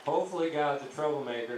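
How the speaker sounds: normal talking voice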